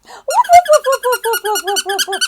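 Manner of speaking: very high loud yappy woofs fading down; puppy barking. Pretend. Teacher voicing the toy puppy for children. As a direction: yap like an eager puppy.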